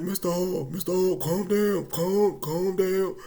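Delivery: loud repeated calm-down, stretched out; pleading paramedics. Retold or imitated. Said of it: "they're like".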